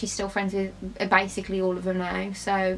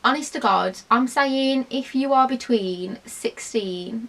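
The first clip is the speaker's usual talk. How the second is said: usual speaking voice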